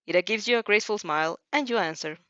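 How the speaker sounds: ordinary delivery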